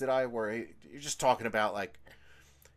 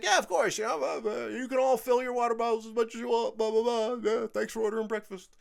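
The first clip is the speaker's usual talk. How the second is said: much higher, bright fake-friendly welcome; overly nice; 8 semitones higher than usual. Server faking friendliness to a customer she dislikes, pitched high. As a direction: gush politely to mask dislike. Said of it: "but it was very nice".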